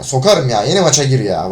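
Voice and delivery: ordinary talk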